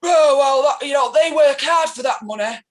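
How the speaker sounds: loud mock-blustering outburst; hammed-up shouted objection; imitated angry retort. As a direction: bellow it over the other person. Acting out angry husband's retort.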